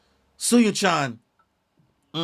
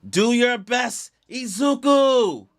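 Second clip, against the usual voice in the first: rallying cheer; 9 semitones higher, 5 dB louder, slower than usual. Acted. Crowd cheering Deku on.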